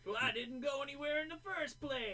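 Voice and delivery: high, loud mock trash talk; cocky fighter boast.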